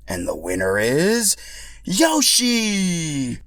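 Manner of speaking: slow build then high shouted name; announcer reveal. Acted. Toad announcing Yoshi as winner, slow then high. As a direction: hold the suspense, then pop the name.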